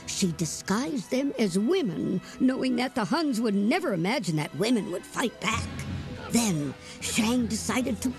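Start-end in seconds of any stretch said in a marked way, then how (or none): none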